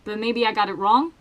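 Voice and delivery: ordinary delivery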